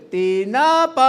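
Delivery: loud, slow, high hawker's cry with a tune; drawn-out musical street cry; vendor's sing-song call. Retold or imitated. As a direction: hawk smoked fish in a long melodic call. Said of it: "May tono pa yun".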